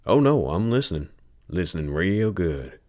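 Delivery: a touch of teasing insistence; mock reassurance. Tobias teasing Lil Red about her music, mock earnest. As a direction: pretend to be all ears.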